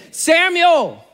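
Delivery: put-on a distant voice calling the boy's name; calling voice of God. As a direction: call out from afar, drawn out. God's voice calls again.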